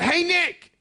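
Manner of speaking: high, loud call across the schoolyard. Retold or imitated. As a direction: shout his name like a girl spotting him. Girl calling out to him.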